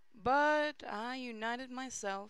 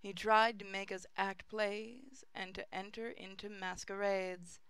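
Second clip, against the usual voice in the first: plain reading voice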